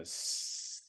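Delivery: long soft hiss; drawn-out quiet "sss"; hissing noise. Retold or imitated. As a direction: imitate a faint hissing sound. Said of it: "like a hissing noise".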